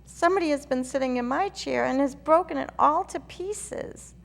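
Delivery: high, loud little bear wail; wee bear crying out. A narrator's reading. Baby bear cries over his broken chair, high and loud. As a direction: squeak it out in distress like a tiny bear. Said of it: "the poor little small wee bear cried".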